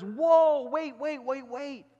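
high-pitched, hammed-up astonishment; acted urgent, amazed protest; alarmed butcher interrupting. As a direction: burst in to stop a scolding. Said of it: "jumps out from behind the corner and says".

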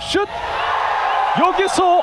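high, loud shout; excited call. Real, not acted. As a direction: shout as the shot goes in. Buzzer-beater shot call.

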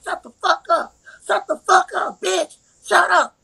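mock angry, repeated command; retold angry order. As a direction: mimic him ordering someone to shut up. Acting out his aggressive restraining order.

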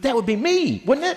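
high, loud hammed-up squeal; gleeful colleague. Roasting a retiring senator, a colleague greedily claims the chair in a loud falsetto. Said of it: "She goes".

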